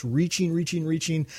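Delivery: lifted, repeated rising reach. Shown as a demonstration. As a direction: echo music climbing in pitch. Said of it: "going higher and higher in pitch".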